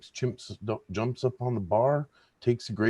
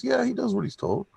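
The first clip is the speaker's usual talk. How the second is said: much higher, bragging voice; proud owner; 13 semitones higher than usual. Acted. Owner bragging about his chimp to the bartender. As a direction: brag it up high.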